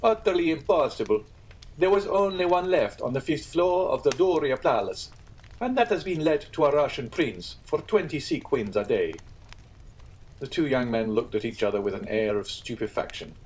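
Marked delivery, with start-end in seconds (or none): 0.0-9.1 higher, emphatic landlord; lightly voiced Italian innkeeper; a hint of the self-important landlord. Pastrini refuses.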